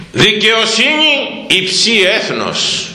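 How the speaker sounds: very slow, high chanted verse; solemn scripture intoning. Real, not acted. Preacher proclaiming the proverb to the congregation, slow and high.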